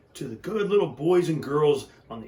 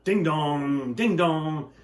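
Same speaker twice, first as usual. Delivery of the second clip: train bell; 3 semitones higher, 5 dB louder, slower than usual. Acted. Train bell sound effect.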